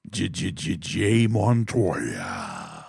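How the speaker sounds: low stuttered intro then a very slow drawn-out name; drawn out deep call. Acted. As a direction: stretch the name like a ring announcer. Calling out silent co-host.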